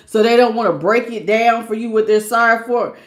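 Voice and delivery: normal talking voice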